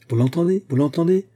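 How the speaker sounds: high, loud, gleeful exclamation; delighted cry. A narrator's reading. Uncle thrilled by his clerk's outburst, delighted shout. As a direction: crow it out, rising at each question. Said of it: "criait Baudu ravi".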